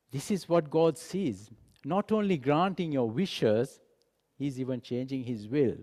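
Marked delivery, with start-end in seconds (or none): none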